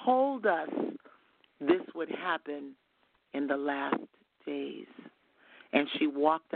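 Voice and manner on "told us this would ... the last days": slow, grave delivery falling to a low close; imitated dropped voice. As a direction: let the voice drop with long pauses. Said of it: "her voice dropped three octaves".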